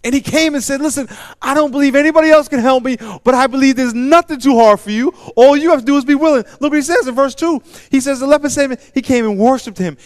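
preacher building to a loud high climax; full-force preaching. Acted. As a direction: drive the story home at full force.

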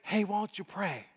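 small, hushed nudge; suddenly soft, like a thought in the head; gentle quiet prompting; little inner voice. Acted. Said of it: "you hear this little voice say".

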